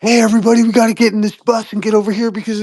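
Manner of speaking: high, urgent, rushed alarm voice; a frantic herder calling everyone to the bus; panicky alarmist. Retold or imitated. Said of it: "someone running into the room and being like".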